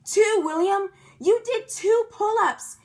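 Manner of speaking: high, loud cheer; excited kid hollering; thrilled holler. A narrator's reading. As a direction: holler it with joy. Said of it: "I hollered, very thrilled".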